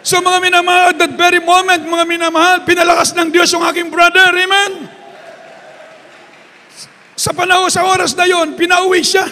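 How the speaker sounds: loud, high-pitched testimony shout; preaching at full force. Acted. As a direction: proclaim God's power to the congregation. Preacher shouts the healing climax.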